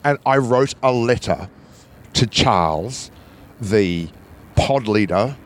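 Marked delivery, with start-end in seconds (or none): none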